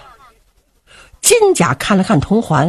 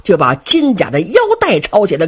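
ordinary delivery, nothing marked

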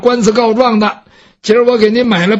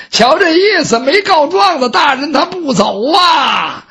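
higher, slow drawn-out crier's call; calling out to villagers; 5 semitones higher, slower than usual. Acted. Fan calls villagers to come file lawsuits, drawn-out whiny holler. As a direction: holler it slowly down the street, whiny and high.

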